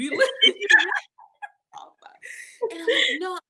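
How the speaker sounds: high, loud laughter breaking in; giggling through the punchline; laughing through words. Real, not acted. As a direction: laugh while telling it. Laughing while retelling officer.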